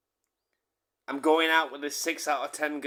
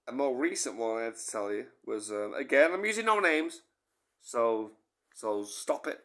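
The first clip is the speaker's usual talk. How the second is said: ordinary delivery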